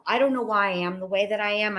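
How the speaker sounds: ordinary delivery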